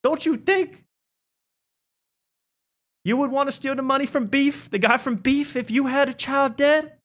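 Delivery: very high put-on voice; mock movie dialogue. Mocking the film's plot in a high put-on voice. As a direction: squeak it out like a cheesy film character.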